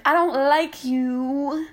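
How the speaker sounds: every word drawn out, high and loud; slow, pointed. Real, not acted. Telling off a persistent guy, slow and pointed. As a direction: spell it out to him.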